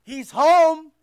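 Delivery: preacher shouting "He's home" to the congregation; proclaimed. Shown as a demonstration. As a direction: boom out "He's home" with force. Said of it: "Now his brother's home".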